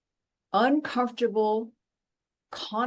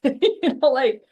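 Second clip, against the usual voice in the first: lachend; 5 semitones higher, 8 dB louder, slower than usual. Real, not acted. Laughs after world wars quip.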